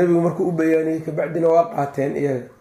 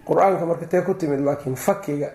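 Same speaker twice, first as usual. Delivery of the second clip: usual speaking voice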